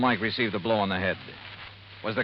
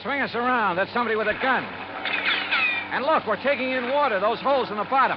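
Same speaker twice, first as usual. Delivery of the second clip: higher, urgent yelling under fire; alarmed shouting; 9 semitones higher than usual. Acted. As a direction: shout over the engine at the partner. Boat under gunfire, shouting orders.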